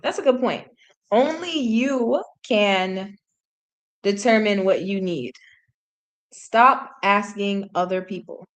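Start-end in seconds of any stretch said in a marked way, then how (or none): 1.1-8.5 slow, weighty delivery of each phrase; dramatic slow reading. Shown as a demonstration. Reading the translated lyric to chat in a slow, dramatic way. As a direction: draw the advice out slowly like a wise saying. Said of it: "I don't know why I said that like that".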